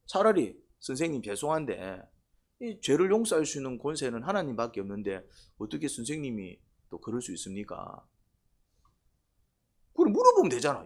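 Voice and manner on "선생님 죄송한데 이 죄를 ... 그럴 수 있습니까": polite soft question. Shown as a demonstration. 공손한 질문 시범.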